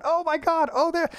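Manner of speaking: high, excited gasp of discovery; sudden realization. Retold or imitated. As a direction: shoot up in pitch as it all clicks. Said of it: "your brain starts connecting dots going".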